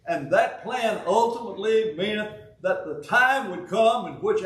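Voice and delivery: ordinary talk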